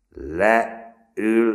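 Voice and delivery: high, loud command; loud repeated command; shouted order. A narrator's reading. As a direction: order them down sharply. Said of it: "ismételte Schulze".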